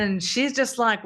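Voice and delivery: ordinary talk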